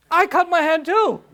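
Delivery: high, loud whining plea; whiny jealous woman. Retold or imitated. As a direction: pout for attention. Jealous woman wants attention too.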